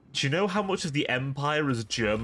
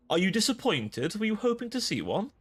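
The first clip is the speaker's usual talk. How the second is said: sing-song tease; 8 semitones higher than usual. Acted. Teasing a viewer named Ren.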